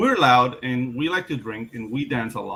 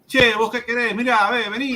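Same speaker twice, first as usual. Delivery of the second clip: Argentine Spanish with a sing-song Italian lilt, pitched up; Italian-sounding Argentine accent; 8 semitones higher, 5 dB louder than usual. Retold or imitated. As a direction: play an Argentinian who sounds Italian, lively and loud. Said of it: "an Italian speaking Spanish".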